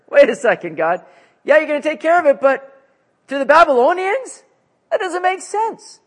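can't believe it, push back high; Habakkuk objecting. Acted. Habakkuk objecting to God, high incredulous protest. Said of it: "Habakkuk has a new concern".

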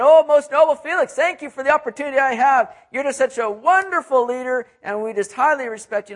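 high, loud, gushing flattery; butter up the governor with fawning praise; flattering. Retold or imitated. Preacher mocks flattering speech, high and loud, gushing. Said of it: "He's not sitting there saying".

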